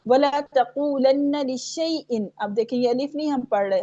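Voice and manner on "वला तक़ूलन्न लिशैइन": slow recited "लिशैइन" as li-shay-in; shin joined to ya. Shown as a demonstration. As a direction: join sh straight to y in "लिशैइन". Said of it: "शीन को या से मिला रहे हैं".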